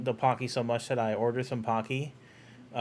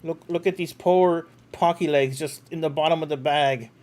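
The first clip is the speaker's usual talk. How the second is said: mock pity; 4 semitones higher, 7 dB louder than usual. Mock pity for broken Pocky.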